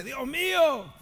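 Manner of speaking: clamor agudo, sin mucho volumen; grito imitado. Retold or imitated. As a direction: imita el grito de Jesús en tono más alto. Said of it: "Jesús está gritando cuando dice". (grito contenido de súplica)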